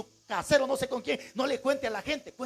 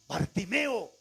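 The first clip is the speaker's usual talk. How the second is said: ordinary delivery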